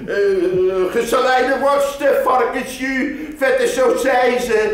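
high, pushy sausage seller. Acted. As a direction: shout the wares at the buyer. (luide marktkoopman)

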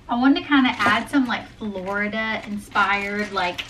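normal talking voice

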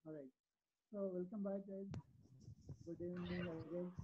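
faint, distant mic sound; barely audible, far from the mic; voice very low. Real, not acted. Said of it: "[name], your voice is low".

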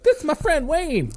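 squeaky high voice showing off a small friend; high mock introduction. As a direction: pipe it up high like a proud little guy. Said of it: "and be like".

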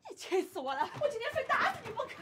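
aunt loses her temper and yells; furious threat. Acted. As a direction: chase her down yelling.

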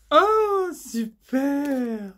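ordinary talk, nothing marked

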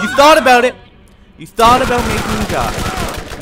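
high, loud shouting; shouting at an enemy who nearly killed him; furious shout. Real, not acted. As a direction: scream it at the enemy.

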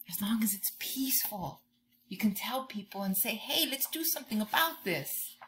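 soft whisper to the class; whispered advice. Real, not acted. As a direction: let them in on it in a whisper.

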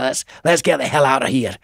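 imitating a Scottish manager's accent. Scottish manager walking out on the label meeting, Scottish accent. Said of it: "with his Scottish accent himself and said".

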